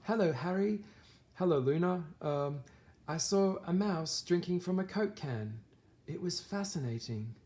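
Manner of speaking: lightly put-on British Luna, wide-eyed; British accent. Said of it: "I'm trying to do my British accent right now".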